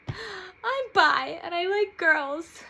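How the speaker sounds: high, loud tearful blurt; tearful confession. Retold or imitated. Coming out to her whole family, high and tearful. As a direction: announce it through tears.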